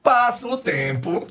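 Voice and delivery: slow, high, stretched-out words; drawn-out storytelling. Real, not acted. Dad telling how time went by, drawn out. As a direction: stretch it to show time dragging by.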